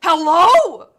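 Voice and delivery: high, loud mocking call; loud challenge. Orc challenges the dwarf, loud mocking call. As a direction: shout it at him.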